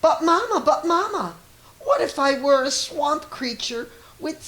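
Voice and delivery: put-on high child voice, insistent; a little kid begging Mama with big what-ifs; high, pleading little child. A narrator's reading. Child voice in read picture book.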